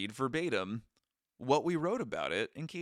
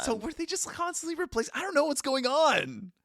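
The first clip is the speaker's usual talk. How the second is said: high-pitched, louder exasperated outburst; bewildered; 4 dB louder than usual. Real, not acted. Friend baffled by the refilled glass, bewildered. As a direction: voice jumps up in disbelief.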